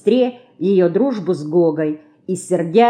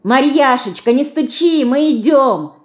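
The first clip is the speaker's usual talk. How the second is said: calling out; 7 semitones higher, 4 dB louder than usual. A narrator's reading. Calling to the banging child.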